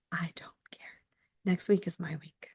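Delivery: barely voiced, near whisper; hushed, savouring. Real, not acted. Teacher relishing her week off, hushed and whispery. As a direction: drop it right down to a whisper.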